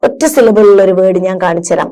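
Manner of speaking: ordinary talk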